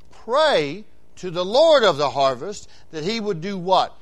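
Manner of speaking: usual speaking voice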